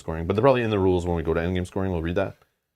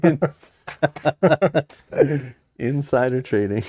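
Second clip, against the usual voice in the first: laughing through the words; giggling while reading it out; 6 semitones higher, 4 dB louder than usual. Real, not acted. Laughing while reading card name.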